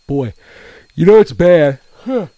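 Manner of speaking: winded, gasping between words; out of breath. Real, not acted. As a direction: talk through heavy breathing. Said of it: "the more out of breath you get".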